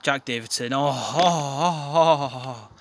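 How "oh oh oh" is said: long, slow, louder ecstatic oh-oh-oh moans; drawn-out goal moan. Real, not acted. Fan moaning in delight as his team scores. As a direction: groan with pleasure at the goal. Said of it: "That was the noise of me climax".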